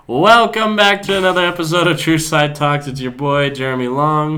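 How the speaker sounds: loud, high show-opener voice; podcast host intro. Acted. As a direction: announce the episode with energy.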